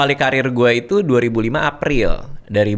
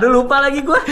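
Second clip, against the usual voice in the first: laughing at himself, pitched up high; 4 dB louder, faster than usual. Real, not acted. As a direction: laugh through it at your own forgetfulness. Laughs at own forgetfulness. (tertawa malu karena lupa)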